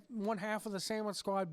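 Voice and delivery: normal talking voice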